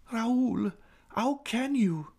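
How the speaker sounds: very high, pleading woman's voice; high, slow cry of hurt; shocked heroine. A narrator's reading. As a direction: reproach him, drawn-out and appalled. Woman shocked by his insult.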